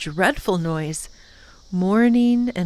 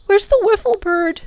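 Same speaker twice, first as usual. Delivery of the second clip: anxious; 12 semitones higher, 4 dB louder than usual. A narrator's reading. Tom looks over anxiously.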